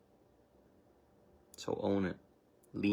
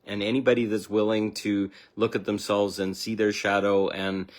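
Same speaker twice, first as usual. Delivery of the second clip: ordinary delivery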